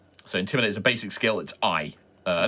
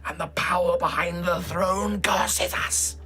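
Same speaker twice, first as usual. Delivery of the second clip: high, fervent preacher voice; street preacher; 8 semitones higher, much slower than usual. Acted. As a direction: zealot greeting a convert. GM plays the preacher.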